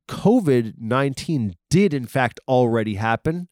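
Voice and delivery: ordinary delivery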